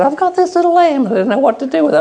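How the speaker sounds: high put-on woman's voice; lady on the phone. Lady ringing the office about a lamb, high put-on woman's voice. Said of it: "some lady rang up and she said".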